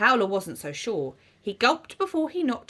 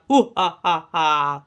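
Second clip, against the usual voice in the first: low, hooting ghostly laugh; spooky ghost; 7 semitones lower, 5 dB louder than usual. A narrator's reading. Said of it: "went the ghost".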